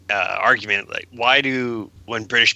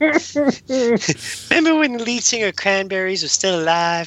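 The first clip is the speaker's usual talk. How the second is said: high, slow put-on critter voice; mock-nostalgic high character voice; squeaky cartoon voice; 10 semitones higher than usual. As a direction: play along in a high cartoon voice. Joins the cartoon nostalgia riff.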